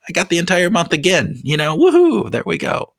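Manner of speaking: high, bright self-cheer woohoo; excited cheer. Retold or imitated. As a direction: cheer yourself on gleefully. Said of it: "it's like".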